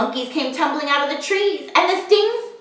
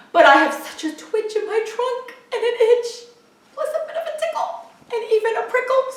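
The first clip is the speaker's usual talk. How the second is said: elephant about to sneeze; 6 semitones higher than usual. Acted. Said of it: "said the elephant sadly".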